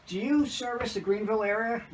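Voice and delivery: a lightly voiced woman on the phone. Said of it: "she goes".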